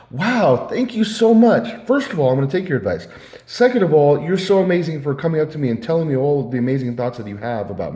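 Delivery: loud, high, hammed-up gratitude; sweet-talking gush. Speaker imagining flattering the man who gave advice, gushing. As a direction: lay on effusive mock thanks. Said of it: "sweet talked to him".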